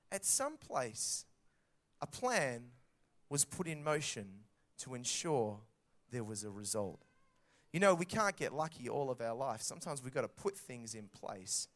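usual speaking voice

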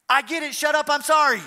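high, loud mock yell running straight into apology; hammed-up high-pitched shout, apology just as loud; retold outburst turned instant apology. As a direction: blurt it loudly and apologize in the same breath. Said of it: "this is the way it sounded".

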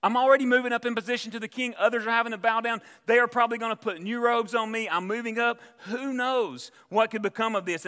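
high, loud, self-satisfied gloating; proud gloating thoughts. Retold or imitated. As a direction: puff up like a proud man rising in rank. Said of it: "He thinks".

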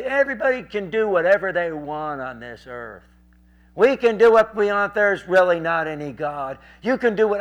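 loud, high argumentative rant; shouting heckler. Retold or imitated. Drinking guy argues with preacher in the crowd, shouting. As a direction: imitate a drunk laying down his philosophy, loudly. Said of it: "they started shouting".

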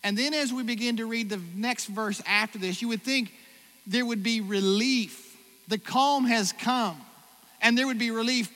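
rising full-voice preaching; preaching build. Acted. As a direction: drive the point home to the crowd. Building sermon climax.

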